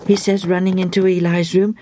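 usual speaking voice

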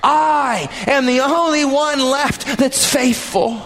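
slow, tearful, overblown complaint; milk the self-pity, slow and near tears; self-pitying lament. Acted. Elijah exaggerates his loneliness to God, slow and tearful. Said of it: "he gives a big exaggeration here".

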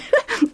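short high chuckle. A narrator's reading. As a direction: chuckle at the memory. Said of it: "Lucius chuckled as he thought of it".

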